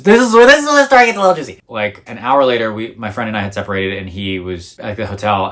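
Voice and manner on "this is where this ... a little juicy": high, loud, excited tease before the twist; teasing build-up. Real, not acted. Vlogger teasing viewers about the juicy twist, high and excited. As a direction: hype up the juicy part.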